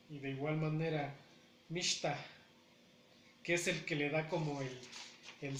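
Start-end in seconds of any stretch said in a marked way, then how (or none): none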